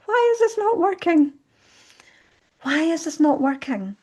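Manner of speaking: reliving a desperate plea, half cried out; retold crying plea. Lying on the hall floor, asking why, distressed high cry. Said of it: "lying on my hall floor crying".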